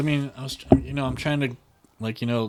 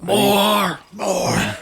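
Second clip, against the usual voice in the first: loud, high mock shout of More; shouting commercial line; 10 semitones higher, 7 dB louder than usual. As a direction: bellow More like the ad. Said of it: "Olive Garden commercial with the Parmesan".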